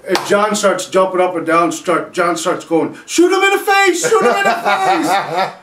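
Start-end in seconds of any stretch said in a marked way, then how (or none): 3.1-5.5 excited yell. Retold or imitated. John egging on his friend holding the gun, excited yell. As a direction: shout it gleefully, higher. John yelling during robbery.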